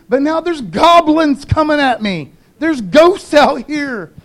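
high, loud, hammed-up panic; freaking-out disciples. Disciples crying out to Peter and Judas in the storm, freaking out. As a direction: wail in mock terror to your boatmates. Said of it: "The disciples are freaking out".

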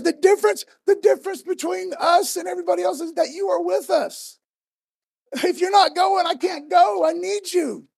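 loud, high, desperate pleading; passionate outcry. Acted. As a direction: Moses begging God to come along. Moses voiced pleading with God.

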